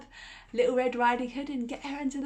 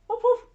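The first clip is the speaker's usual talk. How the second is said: high, loud playful woof; puppy bark; 13 semitones higher, 7 dB louder than usual. Acted. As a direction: bark like a little dog. Puppet dog barks.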